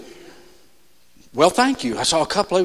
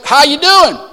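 higher, louder, fake-bright hello; mock cheery greeting; 8 semitones higher, 6 dB louder than usual. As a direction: toss it off like everything's fine. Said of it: "We do it, all of us".

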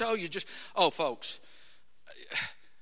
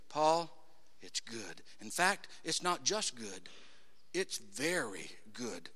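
low, soft and slow; God quietly telling Paul he is very good; tender approval; 10 semitones lower, 9 dB quieter, slower than usual. Pretend. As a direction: bless him gently like a proud father. Voicing God's approval.

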